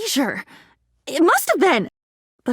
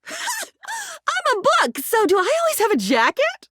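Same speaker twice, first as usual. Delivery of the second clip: delighted laugh; 14 semitones higher than usual. Acted.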